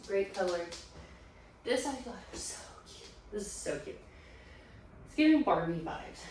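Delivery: usual speaking voice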